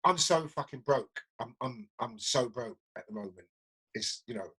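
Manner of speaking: low, trailing-off admission of being broke; matter-of-fact resignation. Retold or imitated. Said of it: "Look, it is what it is".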